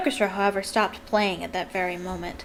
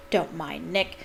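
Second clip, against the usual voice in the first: plain reading voice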